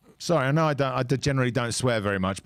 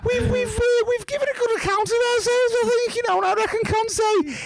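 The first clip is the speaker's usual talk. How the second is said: put-on very high, whiny Scouse accent; hammed-up whiny Scouse pundit. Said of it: "go back to my normal voice now".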